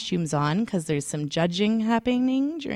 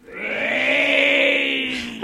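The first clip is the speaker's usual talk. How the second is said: zombie moan; 2 semitones higher than usual. Acted. Zombie customer moans.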